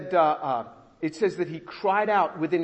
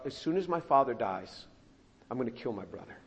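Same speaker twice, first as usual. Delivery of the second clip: lower, much softer, brooding; inner thought; 6 semitones lower, 6 dB quieter, faster than usual. Retold or imitated. Esau plotting to himself, low quiet resolve. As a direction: mutter the plan to yourself. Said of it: "So he thought".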